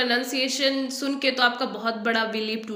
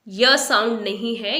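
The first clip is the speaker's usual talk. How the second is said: ordinary talk, nothing marked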